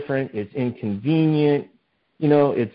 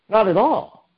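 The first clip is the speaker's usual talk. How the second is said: emphatic answer; 10 semitones higher than usual. Real, not acted. Preacher answers his own question.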